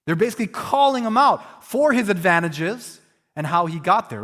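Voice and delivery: usual speaking voice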